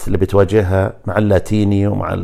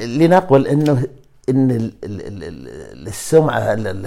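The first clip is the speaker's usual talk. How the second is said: ordinary talk, nothing marked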